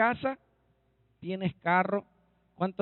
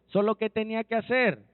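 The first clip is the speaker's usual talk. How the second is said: ordinary talk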